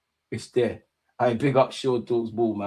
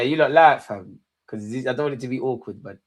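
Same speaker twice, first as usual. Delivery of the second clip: normal talking voice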